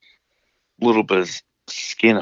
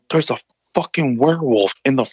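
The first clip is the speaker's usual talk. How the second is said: high, frantic mock panic; imitating brother freaking out; 8 semitones higher, faster than usual. Brother swearing to dad about the werewolf, freaking out. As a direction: insist in a rising, panicked pitch. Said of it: "and he's freaking out".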